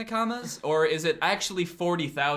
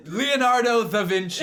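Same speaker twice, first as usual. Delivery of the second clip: superhero introduction; 6 semitones higher, 4 dB louder than usual. Acted. Dramatic superhero-style introduction.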